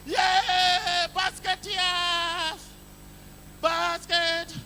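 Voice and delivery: high stretched cheer; slow mock cheer; crowd cheer. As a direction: drag the name out like a crowd.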